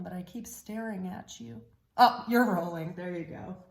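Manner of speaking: ordinary delivery, nothing marked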